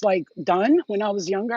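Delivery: ordinary talk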